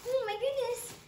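high-pitched excited exclamation. Real, not acted. Unboxing a heap of doll outfits, excited. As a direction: gush in a high, delighted rush. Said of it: "I'm getting a little excited".

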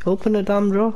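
fed-up plea to the drawer; frustrated. Real, not acted. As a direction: grumble at the stubborn drawer. Stuck hunting for the key.

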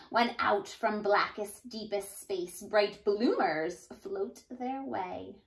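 lively storybook read-aloud voice; animated teacher reading a rhyming book aloud; reading to children. A narrator's reading. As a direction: read it like a bouncy picture book for kids. Reads picture book to children.

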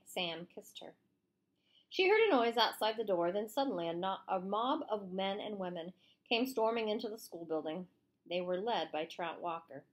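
usual speaking voice

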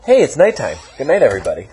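mock surprise. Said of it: "and you're just like".

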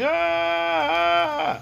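long, high "ah" holler; mock yell. Man down there yelling under the message, high mock yell. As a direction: hold a high yell without much volume. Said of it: "I'm down there yelling".

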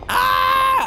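very high, loud shriek of fright; piercing shriek. Real, not acted. Player caught by the monster, shrieking in terror.

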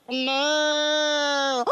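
low drawn-out moo like a calf; cow moo. Acted. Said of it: "It makes me wanna go".

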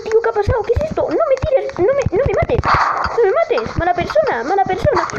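high-pitched, frantic begging; alarmed gamer squeal; panicked pleading. Real, not acted. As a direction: plead for your life in a high voice. Begging not to be killed.

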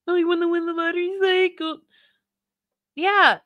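high, fast sing-song mimicry of the streamer; mocking imitation. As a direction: parrot the clip back in a high taunting voice.

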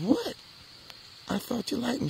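a high, cracking, incredulous what; hurt disbelief. Retold or imitated. Rejected boy to the girl, high and incredulous. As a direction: squeak out your hurt shock.

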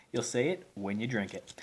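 normal talking voice

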